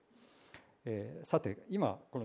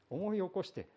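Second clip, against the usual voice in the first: ordinary delivery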